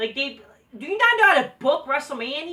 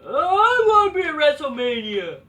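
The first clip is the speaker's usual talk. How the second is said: louder mocking whine; 3 semitones higher, 7 dB louder than usual. Fan mocking wrestlers, louder whiny impression. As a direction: pitch up and push louder on WrestleMania. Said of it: "mock quote, louder take".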